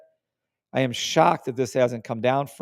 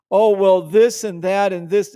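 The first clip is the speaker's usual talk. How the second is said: higher and louder mock complaint; mocking whine; 6 semitones higher, 6 dB louder than usual. Mimicking a member's complaint to viewers, mocking. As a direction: brush off a member's excuse.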